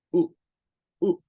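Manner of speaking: U in one very brief burst; short U. Shown as a demonstration. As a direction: clip U short, repeat after a gap. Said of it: "Esta es una U corta".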